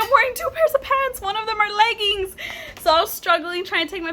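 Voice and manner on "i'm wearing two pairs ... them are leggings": very high, exasperated re-enactment; retold fluster. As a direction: protest in a panicked squeak. Flustered reply to dispatcher retold.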